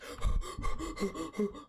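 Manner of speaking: rapid high gasping; mock hyperventilating in panic; panicked gasps. Kid as the door slams, panicked gasping.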